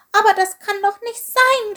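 high, loud, tearful little creature; sobbing. A narrator's reading. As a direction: wail it in a small high voice. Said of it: "schluchzte er".

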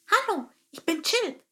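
high chirpy sparrow voice calling out. Acted. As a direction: call down into the rock as a little bird. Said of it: "rief er dann kräftig".

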